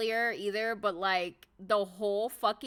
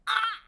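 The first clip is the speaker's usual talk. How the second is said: high flustered squeal; 11 semitones higher, 4 dB louder than usual. Retold or imitated. Sanji took her hand, flustered high squeal. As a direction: squeal like a flattered fan. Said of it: "And I was like".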